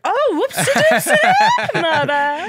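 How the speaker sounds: high, loud giggly apology; laughing mock apology. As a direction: laugh through a fake oops. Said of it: "I'm like".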